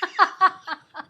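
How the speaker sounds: loud high laugh breaking out; high burst of laughter. Real, not acted. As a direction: laugh out at your own story. Laugh after retold shout. (मोठ्याने उंच हसू)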